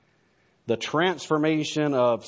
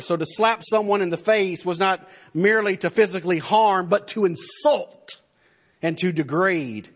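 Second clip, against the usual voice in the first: impassioned preaching, higher and louder; 5 semitones higher, 3 dB louder than usual. Acted. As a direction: preach it with rising fervor. Preaching with force.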